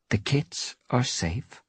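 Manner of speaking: slow, level, final statement; slow firm reassurance. A narrator's reading. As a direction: lay it down as settled fact. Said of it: "her voice menacingly quiet".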